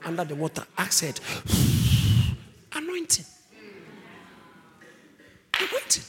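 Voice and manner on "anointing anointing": soaring cry. Acted.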